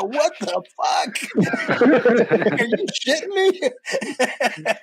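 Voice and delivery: laughing through a mock stunned reply, high and fast; cracking up while acting out shock; laughing disbelief. Retold disbelief at job offer.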